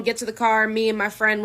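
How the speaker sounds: ordinary talk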